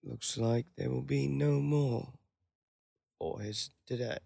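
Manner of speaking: a clumsy try at a hushed British narrator; bad British narrator. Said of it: "Terrible, dude".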